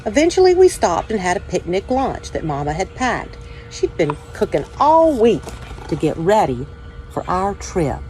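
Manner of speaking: put-on calm, steady read-aloud voice for children; story reading. A narrator's reading. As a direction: tell it gently to young listeners, like at story time. Reads children's picture book aloud.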